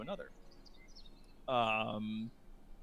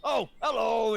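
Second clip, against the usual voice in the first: louder, higher friendly greeting; friendly orc; 8 semitones higher, 7 dB louder than usual. Acted. Orc answering Roosh's greeting, louder and friendly. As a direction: greet them brightly. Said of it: "the orc just goes".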